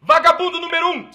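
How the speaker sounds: ordinary talk, nothing marked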